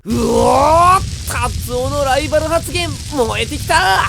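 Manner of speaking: loud, high, fired-up shout. Acted. Fired up by his friend's rival talk, shouting loud. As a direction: roar with rising excitement.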